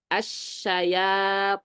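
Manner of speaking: Asyayatinu very slow, heavy emphatic ta; itbaq demo. Shown as a demonstration. Teacher repeating the word for the class, slow heavy recitation. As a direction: lean into Asyayatinu, drawn out. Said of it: "Wattaba'u ma tatlu syayatinu".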